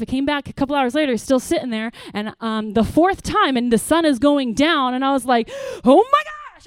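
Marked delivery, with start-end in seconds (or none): 5.8-6.7 high, loud shriek of disbelief; exasperated outburst. Retold or imitated. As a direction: burst out as if losing it at your son. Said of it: "I, like, lost my mind".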